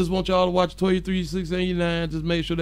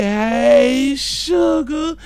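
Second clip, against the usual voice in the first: loud, high, rising greeting; playful greeting call; 7 semitones higher, 7 dB louder, much slower than usual. Pretend. Host greeting a live-stream viewer, loud playful high call. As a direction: call it out high and sweetly drawn out.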